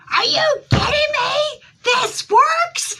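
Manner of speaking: high, loud, cracking disbelief with a laugh; stunned yell breaking into laughter; shocked shout. Retold or imitated. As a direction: shriek it like you can't believe it worked. Shocked first reading works.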